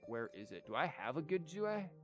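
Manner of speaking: normal talking voice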